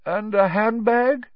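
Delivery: leading teacher's prompt. A narrator's reading. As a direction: coax the next detail out of the pupil. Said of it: "prompted Professor Lupin".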